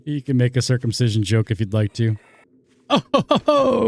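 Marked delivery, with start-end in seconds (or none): 2.9-3.9 chuckling. Acted. Joking after his own pun.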